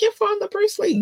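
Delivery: mock high-pitched teasing voice. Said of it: "I got teased about that a lot".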